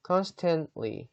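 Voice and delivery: constantly with the final t dropped, very slow; constantly with a near-silent t; silent t pronunciation. Shown as a demonstration. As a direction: say constantly slowly, swallowing the t before ly. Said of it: "여기 끝에 있는 T 발음 있죠".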